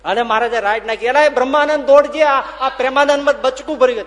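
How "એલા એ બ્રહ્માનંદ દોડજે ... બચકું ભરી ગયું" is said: loud, high, laughing call for help. Retold or imitated. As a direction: shout across to Brahmanand. Maharaj calls out to Brahmanand. (મહારાજ બ્રહ્માનંદને જોરથી બૂમ પાડે)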